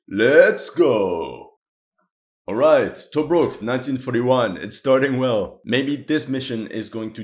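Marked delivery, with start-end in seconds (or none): 0.1-1.5 let's go shout. Real, not acted. Host kicks off the round.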